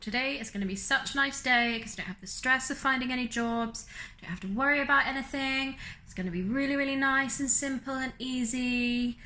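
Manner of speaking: naive high-pitched cheeriness, lightly mocked; mock optimism. Her naive morning thoughts, mocked.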